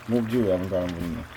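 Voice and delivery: ordinary talk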